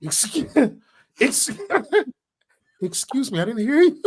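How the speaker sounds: laughing through a fake indignant excuse me; half-laughing mock outrage; mock offended excuse me. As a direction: play it like you're offended and can't keep a straight face. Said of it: "You'll be like".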